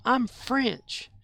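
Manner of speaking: put-on high, proud kid voice; imitating a little boy. Boy telling his family he is French, high and childlike. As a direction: say it like a small boy insisting. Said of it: "He said".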